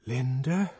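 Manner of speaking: usual speaking voice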